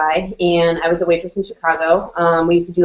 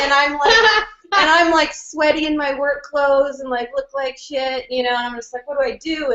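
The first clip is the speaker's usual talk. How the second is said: flustered; 8 semitones higher than usual. Retold or imitated. Retelling panic at work.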